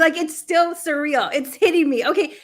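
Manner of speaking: loud and high, real giddy excitement; overwhelmed with joy, still gushing; super excited. Real, not acted. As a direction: let the thrill spill over. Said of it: "I am super excited. That's exactly how I feel".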